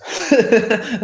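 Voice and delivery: a quick high nervous laugh; short laugh. Real, not acted. Laughing off her own suspicion of split identities. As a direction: laugh it off lightly.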